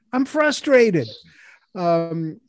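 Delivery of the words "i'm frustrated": higher, exasperated push on frustrated. Real, not acted. Panelist venting about Manchin, exasperated. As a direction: let the frustration spill out. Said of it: "If you can't tell".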